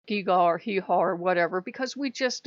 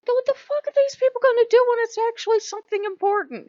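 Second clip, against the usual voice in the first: much higher, louder furious disbelief; exasperated yell; 12 semitones higher, 7 dB louder, faster than usual. Retold or imitated. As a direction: throw it up in outrage. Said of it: "I'm like".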